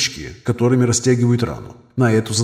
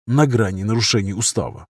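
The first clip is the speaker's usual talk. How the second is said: ordinary delivery, nothing marked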